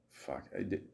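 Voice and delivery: ordinary talk, nothing marked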